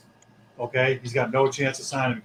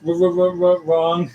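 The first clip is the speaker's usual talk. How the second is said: stammering wrong; 4 dB louder than usual. Pretend. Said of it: "stammered mock wrong".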